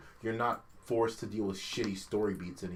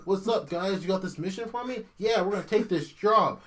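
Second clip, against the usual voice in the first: higher, breezy mock-friendly greeting; breezy casual villain; 8 semitones higher, 5 dB louder than usual. Gamer mocking the villain's cheery greeting, higher and breezy. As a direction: play him as an oblivious cheery guy. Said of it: "Malus ... going from place to place".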